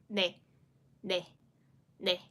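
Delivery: ne with a nasal n, tongue tip lightly bitten; 把“ne”发成轻咬舌尖的鼻音n. Shown as a demonstration. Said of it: "I will go like".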